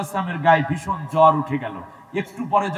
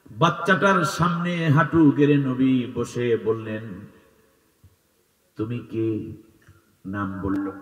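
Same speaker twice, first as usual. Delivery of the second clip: melodic sermon storytelling; 2 semitones lower than usual. Acted. Preacher tells of the orphan child on Eid, melodic chant. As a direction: intone the tale in long sustained notes.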